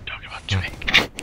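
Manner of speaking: slow, low whispered aside; whispering. Real, not acted. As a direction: murmur it privately, slowly.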